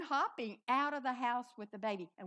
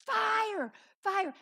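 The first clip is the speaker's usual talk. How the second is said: high, very loud yell; re-enacted frantic scream; panicked alarm shout; 10 semitones higher, 11 dB louder than usual. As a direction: sound the alarm at the top of your voice. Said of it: "I started leaping and hopping".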